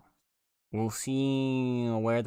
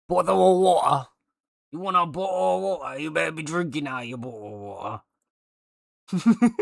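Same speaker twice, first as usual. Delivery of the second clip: exaggerated British accent, higher and louder, bottle of water sneered; scornful posh British mockery of the chat, climbing high on water; 5 semitones higher, 8 dB louder than usual. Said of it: "we love doing British derogatory accent".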